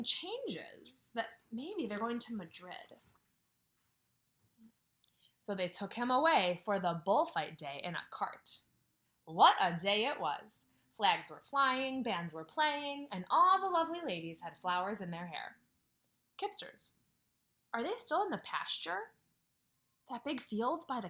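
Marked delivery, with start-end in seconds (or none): 5.4-15.6 lively read-aloud voice; story narration. A narrator's reading. Teacher reading a picture book to her class, lively.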